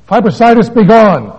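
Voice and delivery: very loud, high command. Retold or imitated. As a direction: banish it like a faith healer. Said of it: "she commanded".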